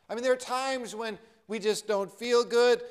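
ordinary talk

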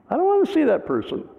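hammed-up high, quick protest; mock reluctant protest. Pastor refusing to meet his accuser, mock high-pitched protest. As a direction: plead off quickly in a comic falsetto.

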